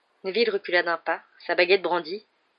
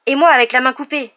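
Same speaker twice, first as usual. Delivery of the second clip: high, loud excited comeback; retorting; 7 semitones higher, 9 dB louder than usual. A narrator's reading. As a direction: top the classmate's boast loudly. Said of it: "répliqua Dean". (réplique vive, haute et forte)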